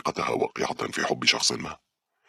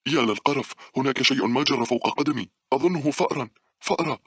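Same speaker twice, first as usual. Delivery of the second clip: much higher, louder panicked cry; rising panicked yelp about a rat; disgusted shriek; 12 semitones higher, 4 dB louder than usual. A narrator's reading. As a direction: shriek as something runs over your foot. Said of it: "صاحت مونيكا".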